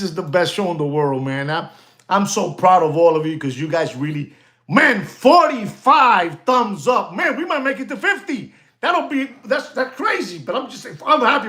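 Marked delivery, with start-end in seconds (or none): 4.7-7.1 slow excited cheer. Real, not acted. Host cheering rising like count. 7.1-11.5 laughing through the words, much higher; giddy laugh at the growing count. Real, not acted. Host laughing to viewers as likes climb, giddy.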